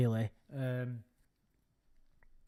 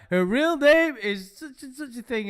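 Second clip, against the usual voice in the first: much higher, louder ranting voice; imitating Lorne; 10 semitones higher, 6 dB louder than usual. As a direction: mimic a worked-up drunken rant. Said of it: "he's like".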